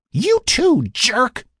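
very high, loud angry scream; screamed insult. A narrator's reading. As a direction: scream it at him in outrage. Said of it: "Sarah screamed".

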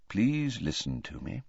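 soft, restrained plea; quiet. Acted. Dumbledore asking angry Harry to listen, quiet. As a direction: ask softly for a hearing. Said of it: "said Dumbledore quietly".

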